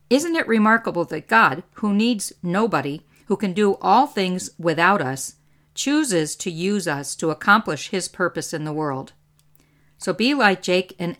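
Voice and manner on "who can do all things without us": ordinary delivery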